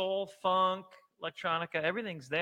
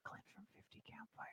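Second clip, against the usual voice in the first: hushed whisper; library whisper; 24 dB quieter than usual. Real, not acted. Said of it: "I'm whispering because I went to a quiet place".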